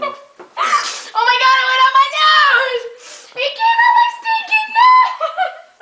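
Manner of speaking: high laughing shout; laughing shriek. Real, not acted. Milk out nose, shrieking laughter.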